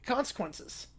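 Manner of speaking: ordinary delivery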